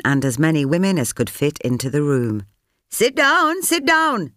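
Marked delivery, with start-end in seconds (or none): 2.9-4.3 instructing. A narrator's reading. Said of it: "she instructed".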